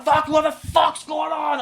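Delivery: high, very loud, slow yell; shouted bravado. Retold or imitated. Driver trying to be brave, escalating into a yell. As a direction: blow it up into an over-bold shout. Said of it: "I'm gonna be brave".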